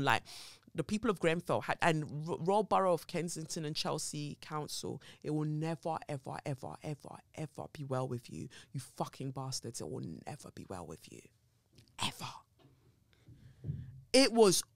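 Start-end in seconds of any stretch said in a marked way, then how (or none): 2.4-12.3 seething quiet curse. Real, not acted. Cursing the council over Grenfell, seething and hushed. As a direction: curse them under your breath with real fury.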